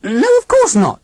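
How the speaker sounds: put-on high, stiff alien old lady; robot aunt voice. A narrator's reading. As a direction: speak like a cold machine aunt.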